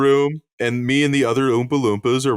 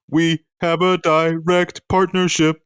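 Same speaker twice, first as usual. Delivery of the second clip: slow deadpan; 4 semitones higher, slower than usual. Acted. Deadpan corporate Oompa Loompa.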